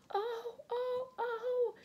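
very high wailing cries; crying out. A narrator's reading. Two girls rush back to the broken table, high cries of dismay. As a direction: wail each one high and loud. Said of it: "cried the two girls".

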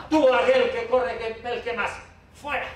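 high, urgent pointing-out call; playground bouncer. Acted. Speaker picks the next child to throw out, high urgent calling. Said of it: "Te pones ahí en una esquina y dices".

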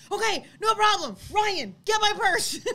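high, loud retold call to a husband; animated mock yell; urgent calling out. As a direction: take charge and shout for help, playfully acted.

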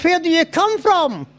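put-on high, drawn-out girl's question; curious girl. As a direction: ask it slowly in a high girl's voice. Joke's London girl asks him.

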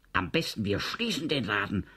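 ordinary delivery

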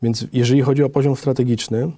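ordinary talk, nothing marked

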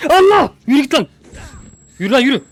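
high, very loud yells; panicked screaming. Real, not acted. Player fleeing the monster, panicked screaming. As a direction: scream it away in terror.

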